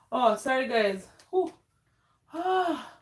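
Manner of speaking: ordinary talk, nothing marked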